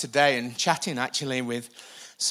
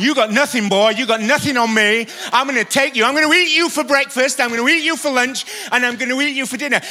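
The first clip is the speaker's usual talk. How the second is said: a lightly voiced demon, louder and higher, taunting; 8 semitones higher, 9 dB louder, faster than usual. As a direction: sneer at him like a bully demon, speeding up on the eats. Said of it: "big ugly demon in your face, talking you down".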